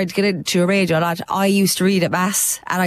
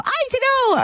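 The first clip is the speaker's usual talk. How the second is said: much higher coy puppet reply; Bosco puppet voice; faster than usual. Retold or imitated. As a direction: answer shyly like a kids' puppet. Puppet answers in retold chat.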